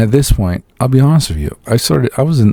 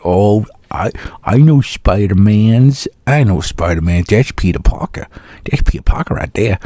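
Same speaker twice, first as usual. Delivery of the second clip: hammed-up accent with dropped R's; movie know-it-all in a made-up accent; unidentifiable accent. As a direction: put on a clumsy, unplaceable accent. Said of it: "I don't know what that accent was".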